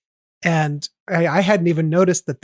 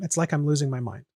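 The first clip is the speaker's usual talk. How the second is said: conversational reading; 2 semitones lower, 5 dB quieter, faster than usual. Shown as a demonstration. Showing how the line sounds when just said aloud, casually. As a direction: toss "It's like I'm losing my mind" off like everyday speech. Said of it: "me just saying that ... sounds the same rhythm".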